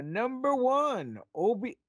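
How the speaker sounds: high, slow drawn-out "number one"; announcer. Acted. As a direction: announce it like a countdown host. Announcing first list item.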